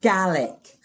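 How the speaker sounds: Gaelic given carefully as GAL-ick; correct Scottish pronunciation. Shown as a demonstration. Answering how you say it, Gaelic as GAL-ick. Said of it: "Is that how you say it?".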